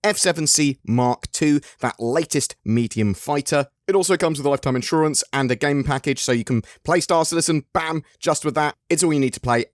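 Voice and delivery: high, fast announcer pitch; giveaway hype. Acted. Host announcing monthly ship giveaway, excited and fast. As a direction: pump up the prize like a showman.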